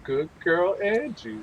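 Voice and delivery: sweet talk the dog. Retold or imitated. Echoing show.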